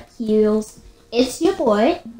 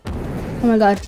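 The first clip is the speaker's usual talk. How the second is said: usual speaking voice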